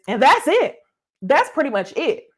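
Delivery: hammed-up brush-off; mock husband. Voicing the husband's dismissive reply.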